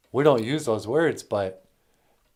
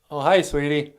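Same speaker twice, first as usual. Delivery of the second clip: ordinary delivery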